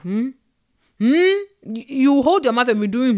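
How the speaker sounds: loud, high warning hum; stern parent hmm. Retold or imitated. Parent warning a child to hold his mouth, stern. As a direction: threaten silence with a sharp hmm.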